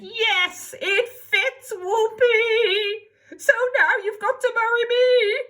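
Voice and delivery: high, loud gleeful screech; screaming. Acted. Ugly sister screaming in glee at the prince, high and loud. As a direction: shriek it in triumph. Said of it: "But she screamed".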